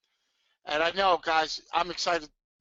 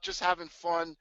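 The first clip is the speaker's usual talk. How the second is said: ordinary talk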